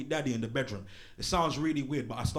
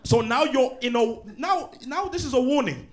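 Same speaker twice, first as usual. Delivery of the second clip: loud, high stern teacher scolding; strict teacher dressing down a pupil; teacher telling off class; 10 semitones higher, 6 dB louder than usual. Acted. Comedian acting stern teacher scolding.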